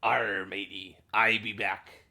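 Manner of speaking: hammed-up pirate growl; playful, loud pirate impression. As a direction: greet them as a stagey pirate. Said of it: "You know what that means?".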